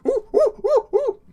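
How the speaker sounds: very high, loud silly yelps; shrill hammed-up cartoon hoots; mock Jar Jar yelps. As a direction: squeal like a goofy alien. Imitating Jar Jar playing.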